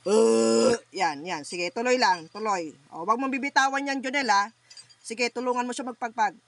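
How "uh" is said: loud groaning grunts. Real, not acted. Healer grunting loudly over the patient in trance. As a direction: push out each grunt from the chest.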